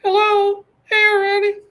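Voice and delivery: squeaky falsetto, wailing and very high; shrill cartoon victim crying out; Mister Bill voice. Streamer greeting his viewers as Mister Bill, very high and whiny. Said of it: "did I just hear a high-pitched voice".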